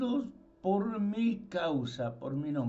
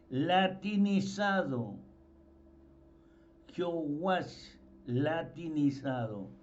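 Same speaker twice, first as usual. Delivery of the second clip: ordinary delivery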